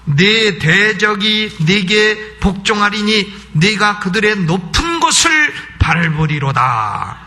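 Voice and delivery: slow, emphatic verse reading with drawn-out endings; declaimed scripture. Acted. Preacher quoting the promise of victory, declaimed scripture. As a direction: proclaim it word by word. Reads scripture aloud with force.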